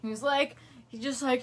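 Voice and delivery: ordinary delivery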